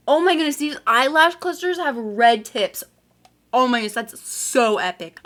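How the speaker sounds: thrilled gush; excited. Real, not acted. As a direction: squeal with delight. Thrilled by red-tipped lashes.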